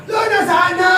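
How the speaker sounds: high, loud cry to God; desperate cry; calling out. Acted. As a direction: shout up to heaven. Joseph crying out to Lord.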